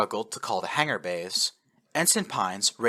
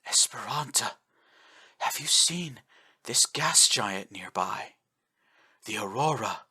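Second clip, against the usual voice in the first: raspy faint whisper; 2 semitones higher, slower than usual. A narrator's reading. Dying admiral to officer, faint raspy whisper. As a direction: breathe the last words. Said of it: "voice was raspy, laced with static".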